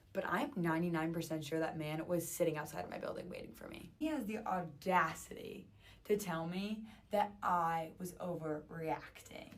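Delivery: usual speaking voice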